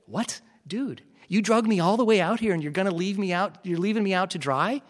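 high, loud and fast, half-joking panic; incredulous, rising complaint to the friend; exasperated protest. Retold or imitated. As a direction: plead with a friend who's leaving you stranded. Pleading with friend on phone.

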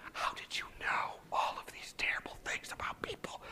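soft, unvoiced whisper with pauses; whispered praise. Praising the patient's gift for spotting problems, whispered. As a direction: whisper the compliments in measured phrases. Voicing what came to him.

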